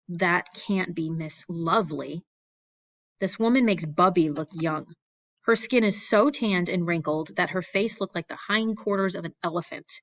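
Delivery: usual speaking voice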